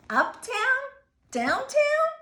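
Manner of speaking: very high, loud, rising questions; boy unsure where to take Otto; puzzled questions. A narrator's reading. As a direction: ask each place wondering aloud. Boy wonders where to take fish.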